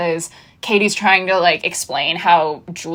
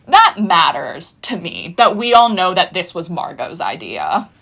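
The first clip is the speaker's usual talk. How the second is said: usual speaking voice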